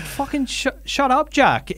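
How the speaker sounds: high-pitched mock yell at brother; exasperated outburst. Telling her brother to shut up mid-argument, a high exasperated mock yell. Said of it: "And I was like".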